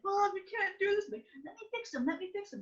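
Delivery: put-on high, whiny kid voice begging mom; pleading child. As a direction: beg mom like an eleven-year-old.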